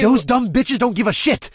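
loud, angry growl. A narrator's reading. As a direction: growl it at her. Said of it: "I growled".

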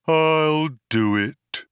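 slow, loud, mournful robot moan; martyred, resounding compliance; tolling cracked bell. A narrator's reading. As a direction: stretch each word like a tolling bell. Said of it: "like the tolling of a great cracked bell".

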